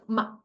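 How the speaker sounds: "ma" very short and light; neutral tone, qing sheng. Shown as a demonstration. Like someone just shocked, "ma" very short. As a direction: let out a quick short "ma". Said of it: "like someone punch you belly".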